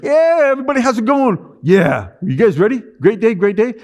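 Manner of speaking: high, loud forced cheer; hammed-up upbeat hello to staff; fake cheerful greeting. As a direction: put on a brave boss face. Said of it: "I'd walk into my company like".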